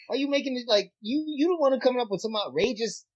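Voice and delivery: high, loud exasperated bro rant; mock-yell at a friend demanding proof; heated retold rant. Retelling an argument with Hector.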